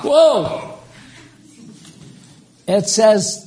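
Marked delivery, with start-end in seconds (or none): 0.0-0.8 high loud delighted cry; excited whoa. Real, not acted. Preacher exclaiming at Jehovah verse, high and excited. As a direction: shout out in wonder.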